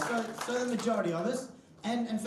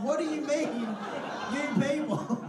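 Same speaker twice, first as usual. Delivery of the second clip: high laughing mock outrage; mock indignant; 6 semitones higher, slower than usual. Comedian pretends outrage at the old lady, laughing. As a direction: act offended through a laugh. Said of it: "I was like".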